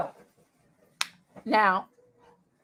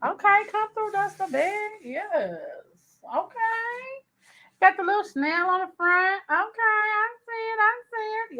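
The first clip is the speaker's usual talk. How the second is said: excited; 11 semitones higher, 3 dB quieter than usual. Real, not acted. Admiring the new bag.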